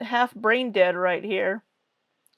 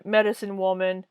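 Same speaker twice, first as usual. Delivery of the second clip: usual speaking voice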